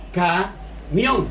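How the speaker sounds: "camión" split in two, pause after "ca"; separado en sílabas. Shown as a demonstration. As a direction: say "camión" syllable by syllable: ca, mión. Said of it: "Tiene dos sílabas". ("camión" en dos sílabas, con pausa: ca, mión)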